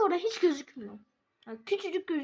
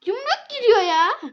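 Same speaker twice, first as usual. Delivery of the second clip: alarmed shout; 7 semitones higher, 11 dB louder, slower than usual. Real, not acted. Alarmed in game fight.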